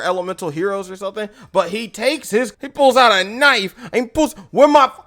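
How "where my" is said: loud, raised-pitch angry demand; mock angry yell; mad. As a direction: imitate a furious card owner demanding answers. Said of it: "He's mad".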